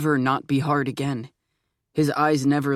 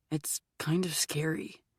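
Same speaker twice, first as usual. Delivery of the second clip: slow, breathy whisper; whispered confession; 8 dB quieter than usual. A narrator's reading. Arthur admitting to Ben that his feelings scare him, whispered.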